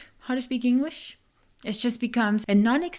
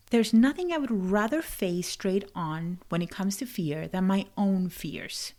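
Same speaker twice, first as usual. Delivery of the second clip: usual speaking voice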